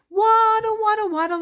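high, sing-song waddle chant; playful waddling. Pretend. Teacher acting out a penguin for children, high playful waddle. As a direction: stretch the first waddle high, then toddle on.

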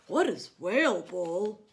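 a touch of a big curious giant; lightly voiced curious whale. Reading to kids, whale asking about the new game. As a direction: ask it with wonder.

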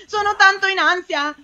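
high-pitched anxious wail; stressed-out, rising complaint. Real, not acted. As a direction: let the worry push the voice up.

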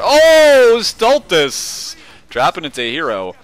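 high loud excited call; excited commentary. Real, not acted. Big play in commentary.

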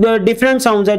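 ordinary talk

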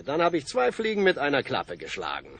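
usual speaking voice

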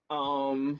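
ordinary talk

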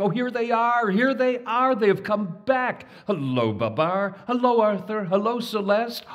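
high, loud, excited cries of welcome; excited shouting. A narrator's reading. Crowd of elephants greeting Babar, excited high shouts. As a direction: elephants calling out in joy as Babar arrives. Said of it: "running and shouting".

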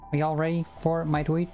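ordinary talk, nothing marked

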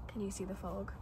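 ordinary talk, nothing marked

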